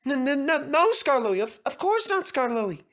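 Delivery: high trembling stammer; quavering meekly. Acted. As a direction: quaver it, meek and scared. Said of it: "quavered the coaches meekly".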